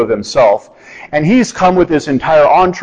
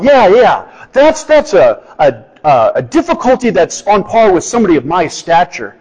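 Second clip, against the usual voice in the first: higher, hammed-up self-important voice; mock pompous Naaman; 5 semitones higher than usual. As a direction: parody a proud man accepting a worthy task. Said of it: "you would have gone".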